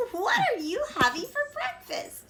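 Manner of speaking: put-on very high old lady voice; granny voice. A narrator's reading. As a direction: chirp like a cheerful granny.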